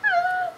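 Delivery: shrill scream of joy; excited scream. Real, not acted. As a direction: squeal with delight. Screaming at being followed back.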